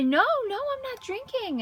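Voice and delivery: insist, pitch jumping up; protesting. Retold or imitated. Sick man denying to the stranger he's drunk, high and protesting. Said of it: "And I say".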